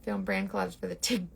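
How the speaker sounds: ordinary delivery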